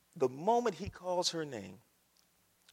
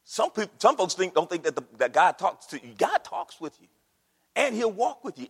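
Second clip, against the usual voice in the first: usual speaking voice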